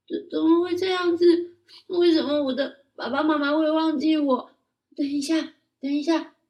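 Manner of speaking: put-on high, loud child voice in a panic; panicked child. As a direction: stammer out the panic. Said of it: "马莫利斯好紧张哦". (高声童音，慌张急促)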